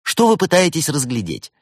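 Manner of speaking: bright-eyed lad answering the helpline; cheerful young technician. A narrator's reading. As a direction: play an upbeat young support worker. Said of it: "молодой и жизнерадостный".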